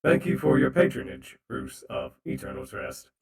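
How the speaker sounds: loud mechanical robot thanks. Acted. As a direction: stiff robot bartender. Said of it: "the robot voice".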